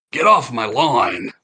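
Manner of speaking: put-on slow, drawn-out grumpy old man; grumpy old animatronic. Old animatronic shouting at the shoppers, slow and grumpy. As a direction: bark it like a cranky old robot. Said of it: "very, like, almost as if, is it really".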